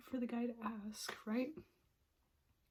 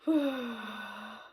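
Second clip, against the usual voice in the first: a loud, weary sigh; exasperated sigh; 6 dB louder than usual. Real, not acted. Fed up with pretending she's fine, a loud sigh. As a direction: blow the breath out in frustration.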